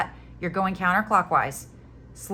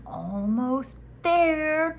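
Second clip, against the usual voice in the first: high, very slow drawn-out movie line; Star Wars quote; 10 semitones higher, 3 dB louder, much slower than usual. Retold or imitated. Explaining her Star Wars reference to viewers, hammed-up high quote. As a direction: stretch both words out, pitched high. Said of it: "I watched Star Wars last week".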